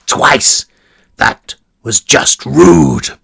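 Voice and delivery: loud, slow, angry warden. Acted. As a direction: snap it out in offended anger. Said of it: "an edge of anger in the warden's voice".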